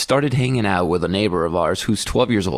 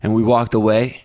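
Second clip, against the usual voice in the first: ordinary talk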